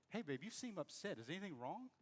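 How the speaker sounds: quietly reach out to her, concerned; gentle check-in. Shown as a demonstration. Husband gently checks on upset wife.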